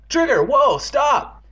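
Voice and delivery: high, urgent yell; shouted command. Acted. Evan shouts to stop his dog, calling out. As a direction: call off a runaway dog.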